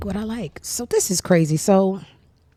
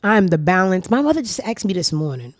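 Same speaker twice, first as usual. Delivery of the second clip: ordinary delivery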